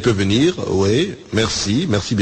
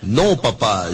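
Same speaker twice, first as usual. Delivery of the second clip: ordinary delivery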